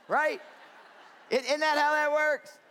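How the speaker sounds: laughing, high and loud at the absurdity. Real, not acted. Preacher laughing to the congregation about drug ads, loud and high. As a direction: laugh it out, amused disbelief.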